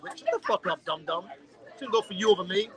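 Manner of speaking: normal talking voice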